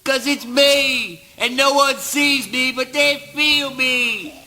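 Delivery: high, ringing declamation; exalted proclaiming. Acted. Eugene boasting that he answers unseen, high exalted declaiming. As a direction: proclaim it high, with mounting fervour. Monologue builds to grand boast.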